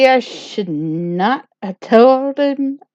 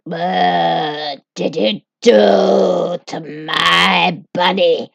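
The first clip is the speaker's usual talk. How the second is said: slow horrified question; 2 semitones lower, much slower than usual. Acted. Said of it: "She, she was like".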